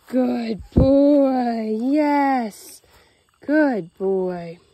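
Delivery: loud praise. Real, not acted. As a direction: reward him loud and long. Praising the dog's down.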